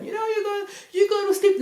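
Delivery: high-pitched imitation of a parent; mock parent. Parents telling kids to go to bed, mock parent. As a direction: put on a high voice for a parent's order.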